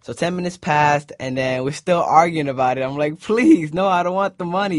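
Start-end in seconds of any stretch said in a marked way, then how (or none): 3.2-4.8 pleading refusal. Retold or imitated.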